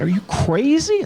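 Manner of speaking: lightly hammed-up wife; wife's exasperated cry. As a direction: squeal it like a stunned wife. Wife voiced in retold story.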